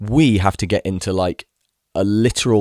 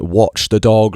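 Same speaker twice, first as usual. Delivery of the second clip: loud retold yell at the owner; shouting a warning to the dog owner, loud; 3 semitones higher, 7 dB louder, slower than usual. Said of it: "screaming at him".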